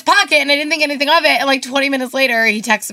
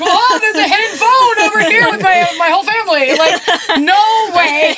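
mock amazement; 5 semitones higher than usual. Acting out mock amazement at trick.

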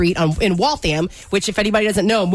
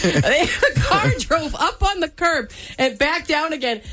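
laughing; 6 semitones higher, slower than usual. Real, not acted. Laughing while retelling curb mishap.

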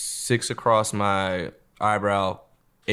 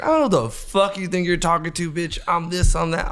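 angry retort; 7 semitones higher than usual. Retold or imitated. Quarrel with a bouncer outside.

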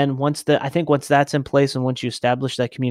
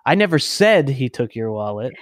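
"said" punched in mid-sentence; stress on SAID. Shown as a demonstration. Showing how emphasis changes meaning, stress on "said". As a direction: lean hard on the word "said". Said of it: "put emphasis on a different word".

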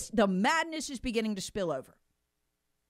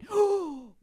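mock gasp; 6 semitones higher, 3 dB louder than usual. Mock shock.

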